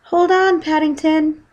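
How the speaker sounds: put-on high, loud girl's call; urgent high call. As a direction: call across the water. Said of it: "called Judy".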